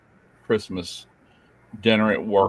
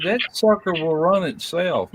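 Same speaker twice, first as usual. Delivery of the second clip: exasperated reassurance; 7 semitones higher, slower than usual. Retold or imitated. Dad reassuring son about grill.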